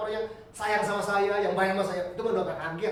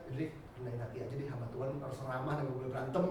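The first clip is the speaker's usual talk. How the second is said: low, soft inner voice; resigned self-counsel; 6 semitones lower, 7 dB quieter than usual. Retold or imitated. Pastor reminding himself to stay humble, low and soft. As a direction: talk yourself down quietly.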